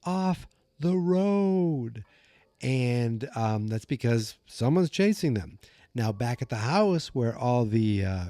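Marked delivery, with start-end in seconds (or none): 0.0-2.0 high, drawn-out imitated call; mock yell. Kids yelling at their driver, high drawn-out mock yell. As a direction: stretch out a mock yell, very slow. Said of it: "They yell at him".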